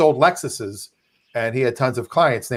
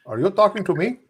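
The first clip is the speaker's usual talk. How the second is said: De Niro impression; 6 semitones higher than usual. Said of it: "I had to".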